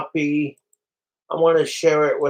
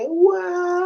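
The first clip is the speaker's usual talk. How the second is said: mock wow. After posting, reacts.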